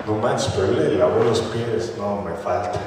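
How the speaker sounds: low, soft, deflated realisation; humbled admission. Retold or imitated. Believer comparing himself to Jesus, humbled, low and soft. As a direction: let the voice sink as you concede.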